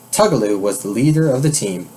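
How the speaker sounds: ordinary delivery, nothing marked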